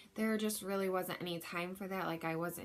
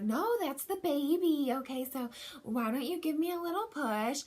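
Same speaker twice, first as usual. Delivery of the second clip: higher, light imitation of an upbeat midwife; mimicking the midwife; 7 semitones higher, 4 dB louder than usual. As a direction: chirp it like an encouraging midwife. Midwife coaching her to push.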